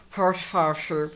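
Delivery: high, loud, mangled Irish; exaggerated Irish accent. Mimicking that guy from the clip, exaggerated Irish accent. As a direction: belt out a garbled Irish impression. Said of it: "I wanna do that guy's accent".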